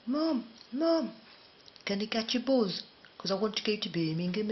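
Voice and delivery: put-on high, loud Brummy child calling Mum; Birmingham accent. Child asking mum about a bus, imitated Birmingham accent. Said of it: "So when I went to Birmingham".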